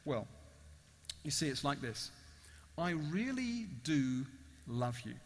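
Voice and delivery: low, soft, unhurried reply; tender confession. Acted. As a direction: confess love quietly, with long pauses. Judge's tender answer.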